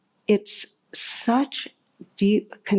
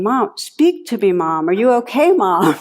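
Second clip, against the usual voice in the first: worried caller; 2 semitones higher, 5 dB louder than usual. Retold or imitated. Daughter on the speakerphone calling out for her mother, worried. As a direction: call out to Mom, getting more urgent. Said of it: "you hear this voice going".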